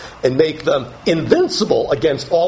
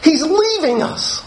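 much higher, dismayed disciple voice, slowing; perplexed disciple; 11 semitones higher than usual. Pretend. Disciple watching Jesus leave, high dismayed disbelief. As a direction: voice the stunned, bewildered disciple. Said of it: "as if to say".

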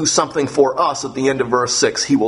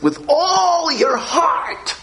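all your heart pulled out slow, much higher, nearly crying; stretched, cried-out emphasis; 14 semitones higher, much slower than usual. Real, not acted. Preacher driving home all your heart to the congregation, slow and high. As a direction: cry out all your heart slowly from the top of the voice. Preacher stresses key words.

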